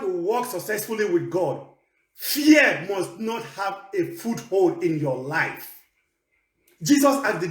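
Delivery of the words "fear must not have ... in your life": ordinary talk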